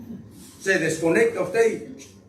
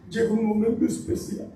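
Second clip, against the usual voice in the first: higher-pitched mock weepy voice; mock tearful; 3 semitones higher than usual. Preacher imitating people who cry easily, mock tearful. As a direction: act out someone about to cry. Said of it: "Y la persona llora".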